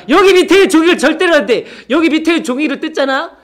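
loud high scolding warning; mock stern shout. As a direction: shout the warning like a playful strict teacher. (장난스러운 호통)

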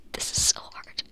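breathy whisper of frustration. Real, not acted. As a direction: whisper it under your breath.

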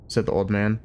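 plain reading voice

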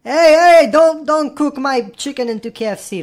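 loud protective yell; shouting. Real, not acted. Protecting his pet chicken.

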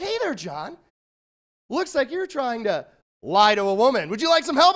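bright pop-up helper voice rising to "help"; Clippy voice. Acted. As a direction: play the eager paperclip helper. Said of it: "he's like".

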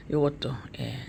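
unvoiced whisper to herself; whispered aside. Real, not acted. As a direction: breathe the words as an aside. Aside while on phone. (속삭이듯 혼잣말로)

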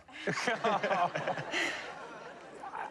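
high, long burst of laughter; real laugh. Real, not acted. Surfer feeling like a jerk after tearful story, laughing. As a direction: break into a high, drawn-out laugh.

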